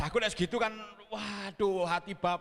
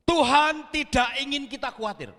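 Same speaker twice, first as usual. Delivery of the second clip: full-force preaching; 7 semitones higher, 6 dB louder than usual. Acted. Pastor preaching to the congregation not to worry, loud and emphatic. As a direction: drive the point home to the church.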